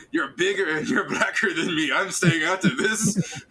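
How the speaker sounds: high, laughing through the words; laughing quote. Retold or imitated. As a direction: chuckle out the excuse. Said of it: "he's looking at Noel".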